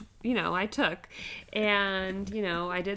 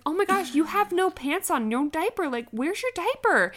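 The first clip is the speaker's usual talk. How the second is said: shocked mom; 10 semitones higher, 5 dB louder than usual. Retold or imitated. Mom finds diaperless son.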